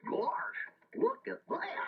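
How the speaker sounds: breathy, very high amazed gasp; astonished gasp. Acted. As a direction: gasp in disbelief at the crash.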